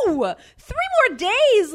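loud, high mock-appalled outburst; outraged disbelief. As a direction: cry out in exaggerated dismay. Retelling outraged reply to desk clerk.

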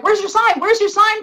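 high-pitched mock clamour; urgent asking. People asking a fan for her sign, urgent. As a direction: imitate excited friends pestering, pitched high.

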